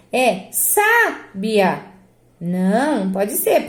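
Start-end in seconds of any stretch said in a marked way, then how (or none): 0.5-1.8 "sábia" with SÁ long and strong; stress on SÁ. Shown as a demonstration. Teacher testing a wrong option, stress on first syllable. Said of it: "qual é a sílaba tônica".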